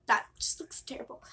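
normal talking voice

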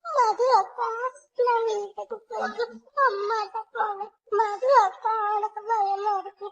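high, teary begging; little girl crying to her sister to go home; crying plea. Acted. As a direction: sob for mummy.

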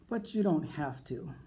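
plain reading voice

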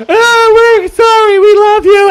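high, loud wail of apology; mock their desperate last cries; hammed-up high-pitched begging voice; mock pleading family. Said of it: "And they're like".

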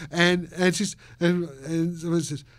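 ordinary delivery

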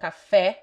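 "Café" with a wide open final e; open, stressed "eh" closing "Café". Shown as a demonstration. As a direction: end "Café" loudly on an open "eh". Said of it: "open and close sounds".

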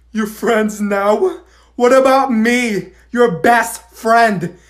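slow, loud, hurt whining; dramatic. Acted. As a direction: overdramatic betrayed friend. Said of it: "can you stop being so dramatic?".

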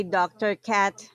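ordinary delivery

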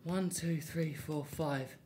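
low soft counting; quiet count. Real, not acted. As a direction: count under your breath.

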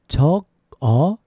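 deliberate, gaps between syllables; slow demonstration. Shown as a demonstration. Teacher showing learners how to ask for writing, slow. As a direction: stretch each syllable out. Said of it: "So a little faster".

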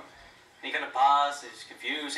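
normal talking voice

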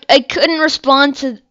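ordinary talk

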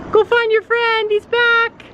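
high, eager encouragement to the horse; urging the horse. Real, not acted. Owner cheers her horse on to find his friend, high and eager.

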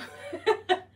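airy burst of high laughter; high breathless laugh. Real, not acted. Vlogger delighted with her new iPhone footage, high airy laugh. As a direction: let a giggle burst out, all breath.

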